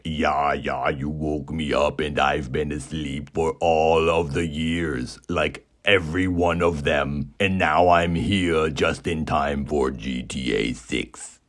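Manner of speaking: low, put-on shadow-man voice; deep, spooky creature voice. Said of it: "a response from a spooky voice".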